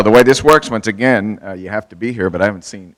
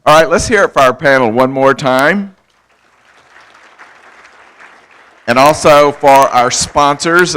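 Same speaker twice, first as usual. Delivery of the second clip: louder, rallying the crowd for applause; loud host call-out; 4 semitones higher, 7 dB louder than usual. Real, not acted. Host asks the audience to applaud the panel, loud rallying call. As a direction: pump up the room like a host.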